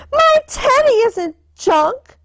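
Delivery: put-on high, childlike protest; little girl pleading for her bear; upset little sister. A narrator's reading. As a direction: cry out to save your teddy. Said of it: "screamed sister".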